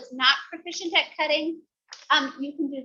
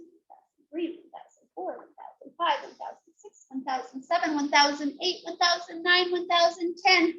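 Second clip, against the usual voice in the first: ordinary delivery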